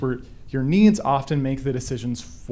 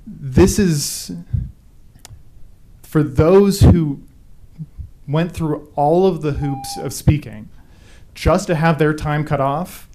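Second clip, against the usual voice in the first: slow, louder, holding back emotion; pulling yourself together; 5 dB louder, slower than usual. Real, not acted. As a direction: push the words out slowly, fighting to stay composed. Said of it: "let me collect myself a little bit".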